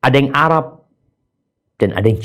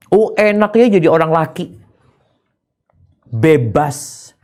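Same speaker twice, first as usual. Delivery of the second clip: envious woman; 6 semitones higher, 4 dB louder than usual. Retold or imitated.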